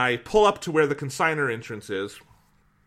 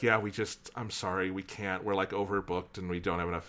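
low, soft imitated apology; apologetic refusal; 6 semitones lower, 8 dB quieter than usual. Shelter staff turning away the couch, low soft apology. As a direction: let them down gently, voice dropped.

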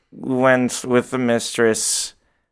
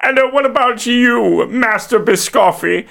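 high, put-on questioning voice; character voice; 8 semitones higher than usual. Game master's character turns to Master Biscotti, high character voice.

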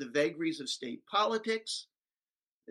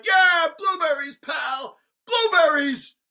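loud, much higher chanting crowd shout; rowdy crowd cheering her point; mock crowd yelling; 13 semitones higher, 12 dB louder, slower than usual. Teachers jumping up and yelling at the businessman, rowdy shout. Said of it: "start yelling".

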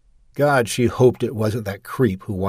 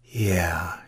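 ordinary delivery

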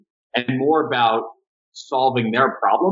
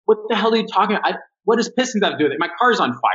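fast, much higher exasperated outburst; freaking out; 9 semitones higher, faster than usual. Retold or imitated. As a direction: blurt it out fast and high, like your car is burning. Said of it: "I'm like".

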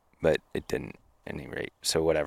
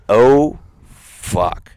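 a sudden loud oh then a curse; shocked outburst; 5 semitones higher, 11 dB louder than usual. Retold or imitated. Telling the podcast host his shocked curse, loud. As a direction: blurt it out, caught off guard. Retold shock as the door closes.

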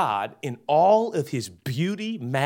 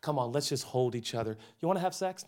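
tender reassurance; 4 semitones lower, 5 dB quieter than usual. Retold or imitated. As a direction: soothe her gently, then sneak in the pitch. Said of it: "you put your arms around her and you say".

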